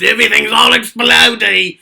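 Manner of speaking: loud Junkrat shout, not quite Australian yet; first take, Australian slipping; weak Junkrat try. Shown as a demonstration. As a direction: shout it out, accent only half there. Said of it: "I don't think that was very good".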